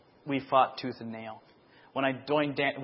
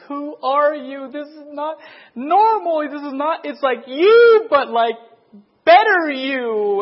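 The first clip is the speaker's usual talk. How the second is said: very high, loud, slow tearful voice; imitating her sobbing; 13 semitones higher, 13 dB louder, slower than usual. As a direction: act out her crying in disbelief. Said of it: "And she's just bawling".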